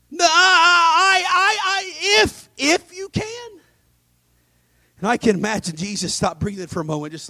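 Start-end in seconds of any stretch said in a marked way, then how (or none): 0.1-3.6 higher, slow halting disbelief; incredulous stammer. Acted. Jesus stunned at the father's doubt, stammering. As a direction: stumble over the words in disbelief.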